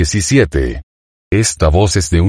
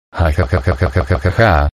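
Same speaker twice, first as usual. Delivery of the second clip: laughing. Real, not acted. Laughing at the mosquito voice.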